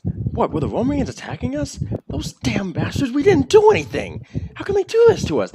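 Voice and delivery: a townsman working himself up into fury; outraged townsman. Acted. Crowd member outraged at attack.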